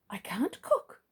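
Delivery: whispered in dismay; Mister Clever whispering his shame. Acted. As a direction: breathe it out, crestfallen. Mister Clever's face fell.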